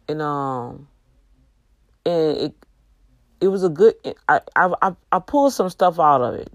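normal talking voice

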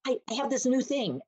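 like a lightbulb just went on; excited discovery. Retold or imitated. A woman tells her community about a new idea, eagerly excited. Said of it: "to go back and go".

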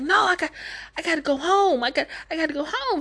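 high, flustered mock old lady. The old lady frets that she must go home, in a high flustered voice. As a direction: fret and insist on going home.

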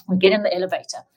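normal talking voice

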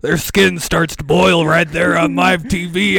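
loud conspiracy-host rant, building; mounting mock outrage on air; Alex Jones. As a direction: testify like a ranting broadcaster. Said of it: "It sounds like an Alex Jones take".

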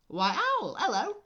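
high, loud squeaky greeting; high cartoon mouse; chirpy mouse. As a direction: squeak a big hello.

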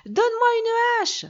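high, loud urgent call. A narrator's reading. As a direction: yell down for help. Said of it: "Jack appela sa mère".

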